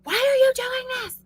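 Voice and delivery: high, exasperated mock yell at the kids; imitating her old frustrated yelling. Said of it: "so frustrated and angry and be like".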